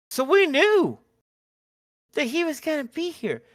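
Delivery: suddenly high and loud; excited. Real, not acted. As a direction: burst out with excitement. Excited retelling, big pitch jump.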